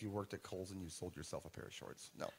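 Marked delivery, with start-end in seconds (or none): none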